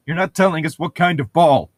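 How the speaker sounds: ordinary delivery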